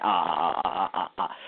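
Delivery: a run of low, dipping "ah" sounds; mock string of dipped "ah" syllables; imitated dipping tones. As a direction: imitate "ah, ah, ah" bobbing down in pitch. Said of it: "Chinese kinda dipping their voice".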